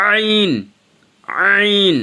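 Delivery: আইন pushed from mid-throat, high and loud; pressed from the throat. Shown as a demonstration. Teacher demonstrating throat pressure on আইন, high and forceful. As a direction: squeeze আইন out from the middle of the throat. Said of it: "হলকের মধ্যখান থেকে pressure দিতে হবে".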